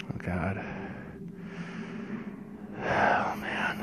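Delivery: soft, sinking whisper of horror; hushed dismay. Acted. Teller recalling a grisly find, hushed dismay. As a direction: breathe it out, barely voiced.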